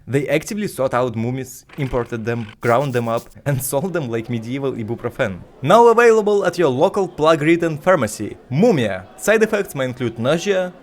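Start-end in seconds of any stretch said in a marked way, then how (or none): 5.6-10.7 upbeat fake commercial read, loud; cheerful ad with eerie menace. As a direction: sell it like a cheery ad with a dark twist. Mock pharmacy ad for mumia.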